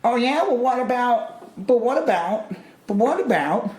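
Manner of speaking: high, whiny what-about voice; mock a whiny heckler.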